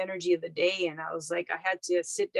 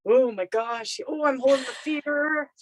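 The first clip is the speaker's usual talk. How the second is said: hammed-up panic rising to a high laughing squeal; mock panic; 7 semitones higher, 7 dB louder than usual. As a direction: playfully act out freezing up in fear. Retold panic at feeling fear.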